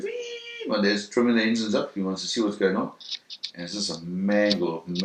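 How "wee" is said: high-pitched drawn-out engine whine; imitated engine whine. Telling how the engines sounded on tape, imitated whine. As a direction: imitate engines revving with a long squeal. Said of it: "you hear the engines going".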